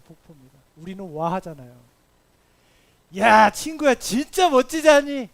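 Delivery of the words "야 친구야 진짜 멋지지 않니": loud, high, drawn-out marvelling shout; awed exclamation. Acted. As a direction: exclaim to a friend at a breathtaking waterfall. Acted awe at the waterfall. (감탄하며 크게 외치는 목소리)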